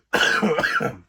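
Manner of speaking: breathy cough. Real, not acted. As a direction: cough then excuse yourself. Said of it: "excuse me".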